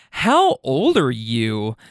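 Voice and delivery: slow, drawn-out challenge, lightly voiced; imitated offended woman. Woman confronts the man who flipped her off, slow mock indignation. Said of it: "she goes".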